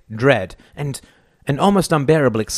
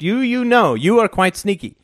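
higher, amused teasing; lightly laughing, lifted voice; 7 semitones higher than usual. A narrator's reading. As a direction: tease with a touch of laughter. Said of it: "She laughed".

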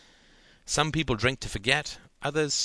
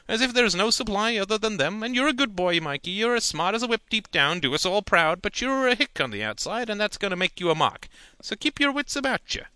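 higher, louder folksy uncle; lecturing uncle; 10 semitones higher, 4 dB louder than usual. A narrator's reading. Uncle warns nephew about Big City Ladies, folksy voice. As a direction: talk down to the boy kindly.

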